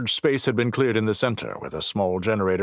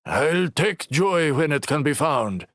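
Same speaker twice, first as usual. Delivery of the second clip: slow drawl; 8 semitones higher than usual. Acted.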